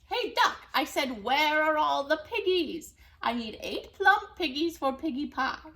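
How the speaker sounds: loud, high demanding witch; bully the duck; bossy witch. Acted.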